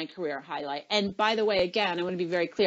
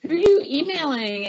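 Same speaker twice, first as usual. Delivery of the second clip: Oprah, teasing; 8 semitones higher, faster than usual. Retold or imitated. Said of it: "she would say something like".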